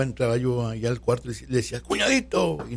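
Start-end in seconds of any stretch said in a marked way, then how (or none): 1.8-2.6 calling his name out high and bright across the room; affectionate high shout of a nickname; playful call-out. Retold or imitated. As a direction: sing out a teasing nickname to wake him up. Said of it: "Le gritaba".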